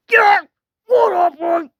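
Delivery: high, loud mock shout; pretend shouting. Demanding what happened in pretend play, high shout. Acted outburst in play scene.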